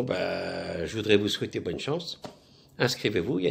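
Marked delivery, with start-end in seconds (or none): none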